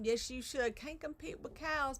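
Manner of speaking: ordinary delivery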